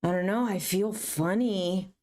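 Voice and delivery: lightly voiced spacey mother; a hint of a woozy mom; mom's dazed voice. Mom confessing to her teen, mildly dazed. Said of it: "She's like".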